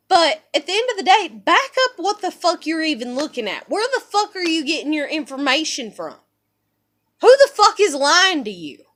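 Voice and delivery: loud, indignant challenge; angry outburst. Real, not acted. Woman challenging critics who misjudge her life, angry outburst.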